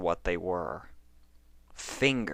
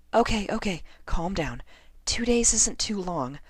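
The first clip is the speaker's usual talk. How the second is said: higher, self-soothing voice; Lyra calming herself. A narrator's reading. Lyra alone steadying her nerves, higher. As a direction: talk yourself down.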